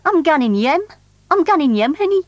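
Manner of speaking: proud broad Geordie, "yem" for home; Geordie dialect. Shown as a demonstration. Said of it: "you say things like".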